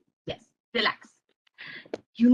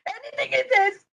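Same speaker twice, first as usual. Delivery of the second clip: high, whiny complaint; sulky little-boy protest; grumbling child; 12 semitones higher than usual. Acted. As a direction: whine in disgust. Boy grumbles about grandpa.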